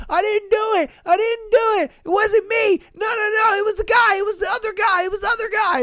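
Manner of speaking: begging them to stop, voice cracking up high; desperate pleading. Acted. Guy pleading with security.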